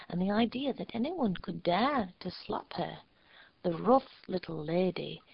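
ordinary delivery, nothing marked

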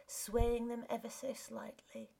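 ordinary delivery, nothing marked

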